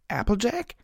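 surprised. A narrator's reading. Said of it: "she thought".

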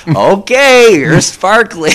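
high, loud mock surrender; exaggerated giving in. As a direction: give in loudly and theatrically. Acting out giving in to demand.

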